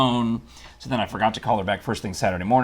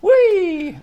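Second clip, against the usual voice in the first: joyful high squeal; excited whee; 9 dB louder than usual. Real, not acted. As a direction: squeal with delight. Celebrating volunteer state news.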